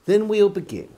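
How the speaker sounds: usual speaking voice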